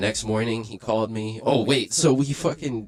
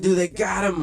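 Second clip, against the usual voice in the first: high, urgent and a bit louder; panicked; 10 semitones higher, 4 dB louder than usual. Retold or imitated. Telling the girlfriend the cops took his friend, high and panicked. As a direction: blurt it out in alarm.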